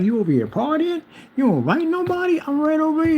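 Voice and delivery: high-pitched playful protest; mock complaint. Teasing the partying neighbor, high mock complaint. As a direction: act put out at being left off the invite.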